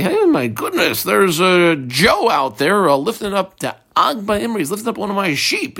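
lightly acted surprise; mock astonishment. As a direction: play a shepherd startled by a thief. Acting out shepherd spotting a thief.

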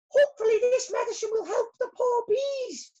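very high little bee voice. Acted. Molly speaks.

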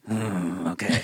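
low, flat shrug of a reply; low dismissive grunt. Retold or imitated. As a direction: mumble it low like you don't care. Old Will shrugging off insult.

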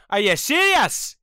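mock Glaswegian "Are you serious?"; hammed-up Scottish voice; Scottish accent. Shown as a demonstration. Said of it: "now we can do it in Scottish".

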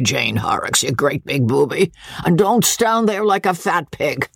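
put-on old country lord greeting guests with a cackle; coarse old baronet. Old baronet teasing his son's wife, leering. Voices old Sir Pitt.

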